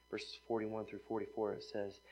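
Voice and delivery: usual speaking voice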